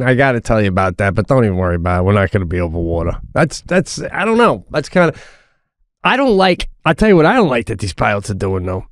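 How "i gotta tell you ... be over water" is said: low, fast offhand reassurance; lightly voiced breezy pilot; casual pilot aside. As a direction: toss it off like nothing. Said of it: "his way of saying".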